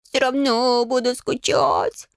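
high, slow drawn-out whine; sulky cat grumble. A narrator's reading. Cat handle still sulking at the girls, grumbling. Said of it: "буркнул кот".

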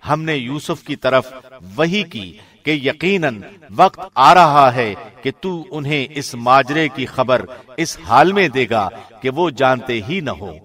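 usual speaking voice

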